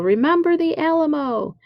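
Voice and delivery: high-pitched rallying yell of Remember the Alamo; battle cry yell. Retold or imitated. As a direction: call it out like troops charging. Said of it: "they used to yell".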